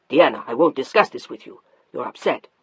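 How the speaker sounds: a hint of a stern husband. Narrator voices husband Mark.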